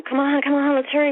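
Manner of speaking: retold chivvying of a sister; hurrying someone along. Rousing her sister for school.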